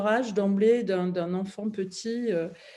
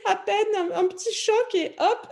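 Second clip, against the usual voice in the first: laughing; 11 semitones higher, 4 dB louder than usual. Real, not acted. Laughing while recalling the crash.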